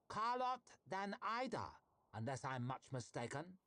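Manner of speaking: high, loud teasing call into the gloom; mocking call. A narrator's reading. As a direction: sing out her name like a trap closing. Vitari calls out mockingly.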